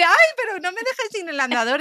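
abuela quejosa, voz más aguda y ajena. Retold or imitated. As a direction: imita a la anciana agarrada a su andador. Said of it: "Y la abuela me dice". (abuela asustada, voz aguda imitada)

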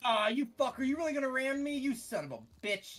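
furious yell at an attacker; angry yelling. Real, not acted. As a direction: curse it out, loud and high. Ship rams him, he curses.

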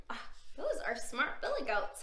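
usual speaking voice

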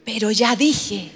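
slow, low "ya dije"; resigned commitment. Acted. Pedro resignado a cumplir. (resignación fingida, lenta y grave)

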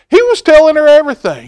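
high, loud and fast; excited reading. A narrator's reading. Telling children how the boy tried everything, excited. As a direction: rush it out, full of energy.

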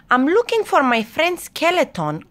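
lightly voiced high-pitched goblin; high, searching goblin line; goblin character.

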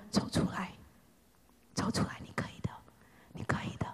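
soft unvoiced coaxing; encouraging whisper. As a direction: whisper them out of the dark. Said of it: "微小的声音". (轻声鼓励)

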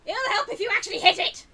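high, very loud complaint; exasperated shout. Real, not acted. Gamer scolding a move that missed, exasperated shout. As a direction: snap loudly at a useless miss.

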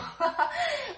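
high, breathless burst of laughter. Real, not acted. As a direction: crack up at the card. Laughing at the cards.

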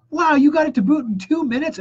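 high, amazed customer on the phone; genuinely excited customer. Retold or imitated. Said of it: "they're genuinely excited".

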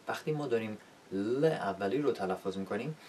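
normal talking voice

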